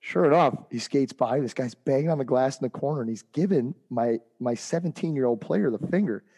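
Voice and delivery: ordinary delivery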